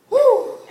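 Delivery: loud, very high celebratory whoo; excited whoop. Acted. Salesman spotting his direct deposit, whooping excitedly. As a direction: let out a high whoop of joy. Reenacts seeing the unexpected deposit.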